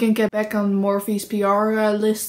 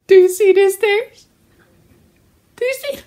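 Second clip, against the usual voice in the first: high, insistent mock plea; mock pleading; 9 semitones higher, 6 dB louder than usual. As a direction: push the fake tears at the camera. Showing off fake tears.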